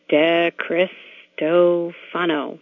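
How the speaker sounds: De Cristofano very slowly, broken into syllables; pronounced as spelled. Shown as a demonstration. Showing people how to read her surname, De Cristofano slowly syllable by syllable. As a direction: sound out De Cristofano piece by piece, as written. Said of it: "pronounced just like it's spelled".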